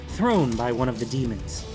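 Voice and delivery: ordinary delivery, nothing marked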